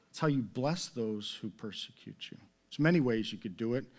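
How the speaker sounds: ordinary delivery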